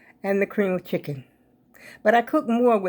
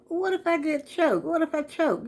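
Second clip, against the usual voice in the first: much higher, fretful thought voiced to herself; worried inner voice; 10 semitones higher than usual. Vlogger telling viewers her bedtime gum fear, worried higher voice. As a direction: pitch the scary thought up high, half-joking. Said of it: "So to myself".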